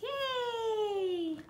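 ordinary talk, nothing marked

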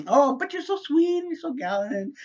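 high-pitched, put-on gushing wife voice; mock sweet-talk. Wife flattering husband after criticizing him, mock sweet.

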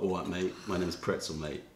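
a gruff lad greeting you, mate; Pretzel deep voice. Retold or imitated. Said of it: "doesn't mean he doesn't have a deep voice".

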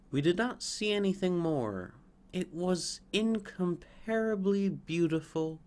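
a hint of awed sparrows; sparrows' voice.

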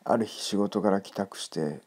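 plain reading voice

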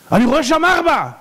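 high, loud cry of amazement; stunned high-pitched outburst; astonished king. Acted. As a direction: exclaim it in disbelief, pitch leaping up. Acted astonished king seeing the furnace.

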